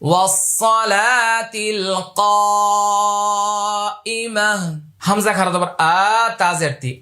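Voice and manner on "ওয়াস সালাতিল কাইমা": slow, higher, drawn-out chant; dua recitation. Shown as a demonstration. Teacher recites the dua to students, slow melodic chant. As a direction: intone the prayer, lengthening every vowel.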